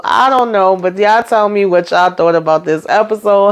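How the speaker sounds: usual speaking voice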